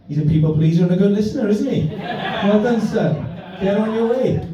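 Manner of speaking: hammed-up proud father; mock proud dad. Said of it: "I said".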